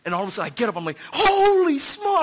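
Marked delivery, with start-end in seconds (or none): 1.1-2.2 high, loud drawn-out holler; excited yell. Retold or imitated. Fan watching a football catch, yelling. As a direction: yell it like a sudden touchdown. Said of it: "why do you have to yell that loud".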